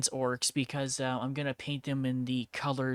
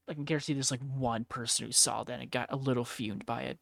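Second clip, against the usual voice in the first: ordinary talk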